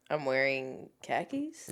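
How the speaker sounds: imitating a Boston accent; low, slow Boston accent. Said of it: "I wanna hear [name] say the words".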